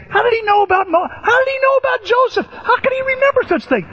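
very high-pitched incredulous questions; acted astonishment. Marveling how he remembered Joseph, in high mock amazement.

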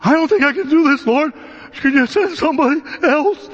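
a lightly acted Moses begging to be spared; fearful Moses. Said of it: "Moses is terrified".

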